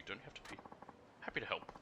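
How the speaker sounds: ordinary delivery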